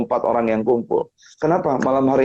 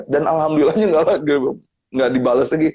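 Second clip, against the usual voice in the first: ordinary delivery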